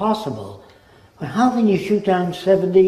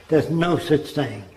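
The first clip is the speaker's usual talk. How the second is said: ordinary delivery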